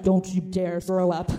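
slow, low mock threat; stern warning. Sister warning her queasy sibling on the ride, stern.

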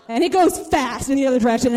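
ordinary delivery

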